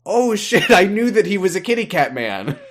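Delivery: high, loud retold shock; sudden realization. Host recalling seeing the actor on screen, sudden realization. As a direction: act out the moment it all comes back. Acting out own sudden recollection.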